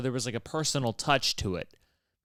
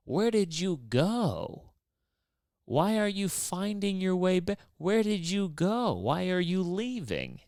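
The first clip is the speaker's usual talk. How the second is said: higher, slow, probing questions; mock questioning; 7 semitones higher, slower than usual. Real, not acted. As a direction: question him mockingly, slowly.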